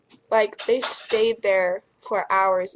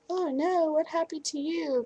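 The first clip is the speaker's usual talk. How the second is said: shocked passerby; 8 semitones higher, 4 dB quieter than usual. Retold or imitated. Passersby gasping at bloody foot.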